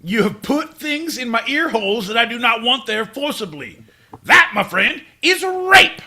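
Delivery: high, building to a loud shout; hammed-up indignant tirade; mock outrage. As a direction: declaim the accusation, exploding on the last word. Acted outrage at band.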